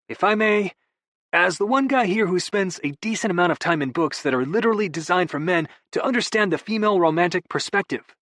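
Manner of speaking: a hint of a sheepish brother; [name]'s voice. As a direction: play it off with easy charm. [name] holding forth.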